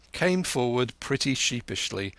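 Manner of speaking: plain reading voice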